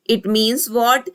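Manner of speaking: ordinary talk, nothing marked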